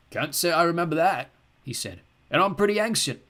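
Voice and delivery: a hint of a creaky old man chatting. A narrator's reading. Old man on the bus chats to the boy, lightly voiced old man.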